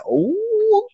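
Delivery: high, drawn-out squeal of "oh"; thrilled reaction. Retold or imitated. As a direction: re-enact your delight with a soaring ooh. Retold thrilled reaction to confession.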